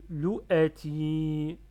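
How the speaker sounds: LOU A TI loud and linked, long final i; joined syllables. Shown as a demonstration. Teacher removes barriers between syllables, linked and drawn out. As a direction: slowly join LOU A TI, holding ti. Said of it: "j'enlève les barrières une à une".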